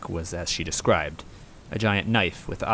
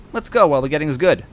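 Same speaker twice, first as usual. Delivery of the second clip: high, loud, fast eager push; eager urging; 8 semitones higher, 10 dB louder, faster than usual. A narrator's reading. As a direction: rush it out, itching to go. Gumbella urging.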